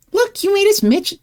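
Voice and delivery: ordinary talk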